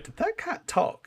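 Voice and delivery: mock-amazed did that cat talk; incredulous question. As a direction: act baffled at the idea. Retelling their puzzled debate.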